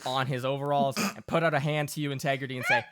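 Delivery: normal talking voice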